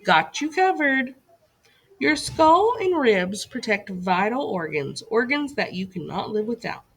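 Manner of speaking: normal talking voice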